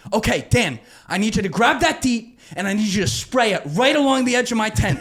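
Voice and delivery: keyed-up pleading instructions; urgent orders. Retold or imitated. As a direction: command him fast and high. Urgent orders to his friend.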